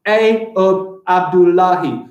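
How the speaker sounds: slow, evenly beaten "Ayub Abdullahi"; drawn-out demo of "Ayub Abdullahi"; syllable by syllable. Shown as a demonstration. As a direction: count out "Ayub Abdullahi" one syllable at a time. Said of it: "That is six syllables".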